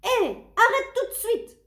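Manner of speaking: put-on high, loud outraged child shout. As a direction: yell at the monster to stop. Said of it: "crie Tony".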